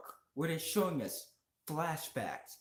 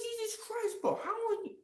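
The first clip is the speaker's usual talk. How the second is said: retold reaction, voice pitched way up in outrage; exasperated disbelief; 11 semitones higher than usual. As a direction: squeak it out in total disbelief. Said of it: "He's like".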